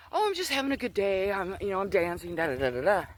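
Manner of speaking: high start, easing down into casual da-da-das; lightly singsong brush-off; breezy self-quote. Retold or imitated. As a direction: act out a carefree reply. Her usual breezy answer to mom.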